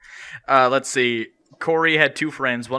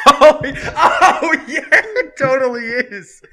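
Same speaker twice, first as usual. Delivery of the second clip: high, loud laughing through words; 12 semitones higher, 10 dB louder, much slower than usual. Real, not acted. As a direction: crack up while agreeing. Cracking up at joke.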